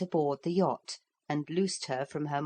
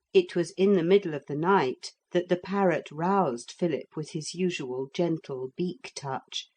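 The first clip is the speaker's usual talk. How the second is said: ordinary delivery, nothing marked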